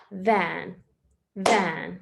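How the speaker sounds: "Van" said once, cleanly, one beat per clap; clapped syllables. Shown as a demonstration. Teacher claps "van" out in one beat for pupils. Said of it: "let's clap it out".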